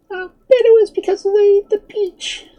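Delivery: very high, loud kitten voice. A narrator's reading. Kitten explaining the vanished piglets, high-pitched and knowing. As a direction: speak as a little kitten, pitched right up.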